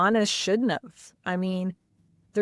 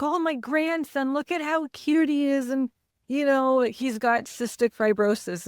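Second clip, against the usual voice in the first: higher-pitched imitation of a gushing dad; doting grandfather; 6 semitones higher than usual. Dad telling everyone about his grandson, imitated gushing. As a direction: lightly mimic a grandpa showing off, pitched up then easing down.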